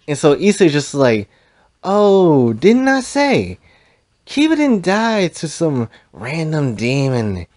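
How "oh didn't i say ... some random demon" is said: taunting. Retold or imitated. Villain gloats about the killing.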